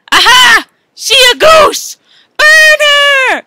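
high, loud accusing yell; triumphant shout. Acted.

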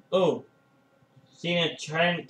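ordinary delivery

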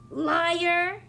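put-on indignant little girl. Sister accuses Ian.

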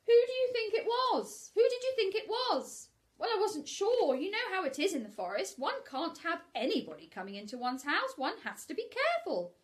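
very high, loud fussy Rabbit. Acted. Rabbit explaining to Pooh, fussy high voice. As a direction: fuss about being careful. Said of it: "Rabbit chatting".

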